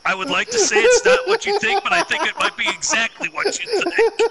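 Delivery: high laughing voice; barely holding back laughter. Real, not acted. Laughing joke to Dale.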